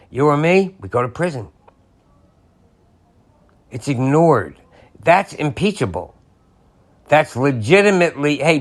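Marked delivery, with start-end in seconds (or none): none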